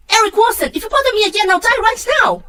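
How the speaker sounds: high, loud shriek of despair; furious scream. Acted. Daughter screaming at her father to leave her alone, furious. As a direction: scream it, begging to be left alone. Same outburst replayed.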